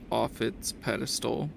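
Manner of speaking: usual speaking voice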